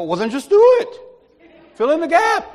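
loud, high exhortation; emphatic urging. Retold or imitated. Friend telling Ray to fill in, loud exasperated urging.

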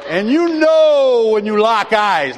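slow, loud, high movie moment; big drawn-out suspense; slow motion. Acted. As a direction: stretch it out like slowed film. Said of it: "all of a sudden it slows down".

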